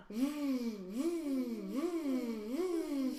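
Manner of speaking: buzzing v sounds held on the lip; vampire bat v sound. Shown as a demonstration. Mom shows the Vincent Vampire Bat v sound, buzzing each v. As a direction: buzz each v like a bat's wings. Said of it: "and we're gonna go".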